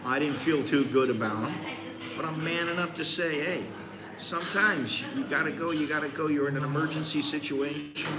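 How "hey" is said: ordinary delivery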